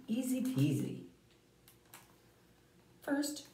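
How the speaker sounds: lively picture-book read-aloud; reading to children. Acted. Teacher reading to the class, storytelling voice. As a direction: tell the story to a class of kids.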